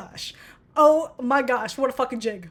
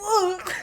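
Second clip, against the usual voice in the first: imitated singer's groan; 6 semitones higher than usual. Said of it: "He's like".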